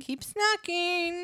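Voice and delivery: high, slow goofy sign-off; silly high voice. Pretend. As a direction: stretch it out playfully.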